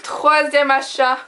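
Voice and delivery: normal talking voice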